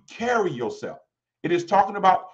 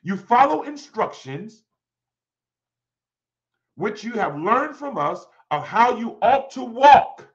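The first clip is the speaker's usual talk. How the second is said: slow, raised-pitch declamation; emphatic scripture reading; 4 semitones higher, 5 dB louder, slower than usual. Real, not acted. Preacher reading how you ought to walk, slow and emphatic. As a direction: press each phrase home with long pauses.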